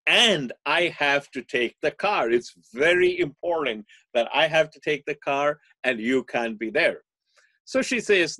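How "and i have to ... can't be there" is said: put-on louder, higher insistent teen, stretched out; mock pleading, dragging the words; pleading son. Son begging his mother, hammed-up insistence. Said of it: "So he said".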